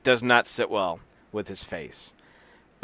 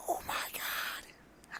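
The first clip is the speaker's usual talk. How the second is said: a hushed, breathy gasp of scandal; gossipy whisper; 19 dB quieter, much slower than usual. Acted. Host gossiping to co-host about the wrestling couple, whispered.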